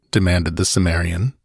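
plain reading voice

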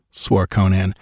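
plain reading voice

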